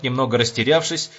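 ordinary delivery

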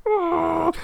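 a very high, squeaked oh of shock; squealed oh. Retold or imitated. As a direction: let the oh fly way up in disbelief. Emotional pendulum, inner reaction.

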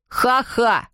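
spoken, scornful ha-ha; mock laugh. A narrator's reading. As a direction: throw out a mock laugh as words. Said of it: "закричал Жофруа".

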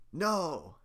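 high scared shout; frightened cry. Acted. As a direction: yelp in terror. Villager cries out in fear.

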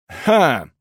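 narrated short cough-like "ha"; throat clearing. A narrator's reading. Count stalling to escape an awkward situation, clearing his throat. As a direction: clear the throat on one syllable. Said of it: "откашлялся я".

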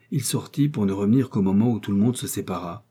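plain reading voice

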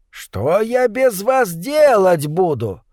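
slow, high-pitched wail of dismay; moaning lament. A narrator's reading. Doctor left behind by his departing companions, moaning in dismay. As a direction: lament it, drawing out the words. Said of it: "охал Лоус".

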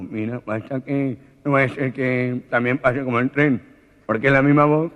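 the nasal man shouts at the unseen voice; startled nasal man. Acted. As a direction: yell it in a panic. Gangoso voice.

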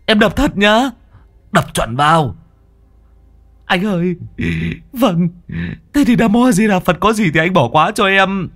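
Long begs his boss's pardon before striking; nervous pleading. Acted. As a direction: pray for pardon before the blow.

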